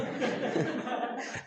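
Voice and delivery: airy high laugh; a short silent chuckle; breathless laugh. Real, not acted. Teacher laughs at own joke.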